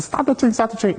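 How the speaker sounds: high, frantic shout for help; panicked high yell at the train as the thief takes the bag; panicked scream. Retold or imitated. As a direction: yell it at the top of your lungs to stop the train. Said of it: "I'm screaming".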